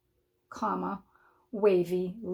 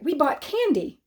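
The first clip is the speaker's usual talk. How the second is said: excited; 6 semitones higher than usual. Shown as a demonstration. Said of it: "let's say you're excited that you bought candy".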